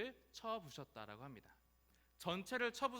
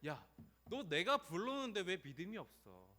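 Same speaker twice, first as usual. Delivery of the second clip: raised, reproachful voice; acted rebuke, demanding an explanation; scolding; 5 semitones higher, 3 dB louder than usual. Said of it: "이렇게 질책하거나 막 정죄하는 느낌". (질책하듯)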